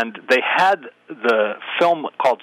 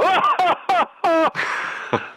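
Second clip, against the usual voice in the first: very high mock laugh; high, hammed-up "ha ha" cackle; loud forced laugh; much slower than usual. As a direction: fake a big, deliberate audience laugh. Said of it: "as loud as I could, I'd just go".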